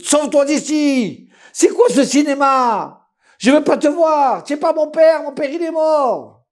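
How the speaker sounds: furious yelling. Acted. Son screams at the man claiming to be his father. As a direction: scream him away.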